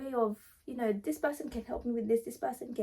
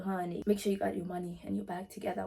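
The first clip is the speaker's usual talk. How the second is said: ordinary delivery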